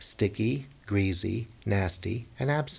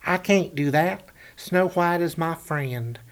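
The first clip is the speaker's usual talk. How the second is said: high, slow, louder timid hunter; 7 semitones higher, 5 dB louder, slower than usual. A narrator's reading. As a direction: squeak it out, pleading. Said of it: "the hunter said".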